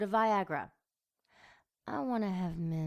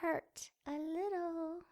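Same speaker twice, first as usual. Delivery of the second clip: sing-song mock comfort; 11 semitones higher, 5 dB quieter, slower than usual. A narrator's reading.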